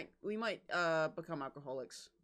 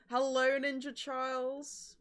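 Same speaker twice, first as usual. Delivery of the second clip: calling out; 7 semitones higher, 5 dB louder, slower than usual. Real, not acted. Calling a greeting to a viewer.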